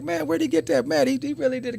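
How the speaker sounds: high, impressed exclamation; amazed. Retold or imitated. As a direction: marvel at it, pitch way up. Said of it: "everybody's like".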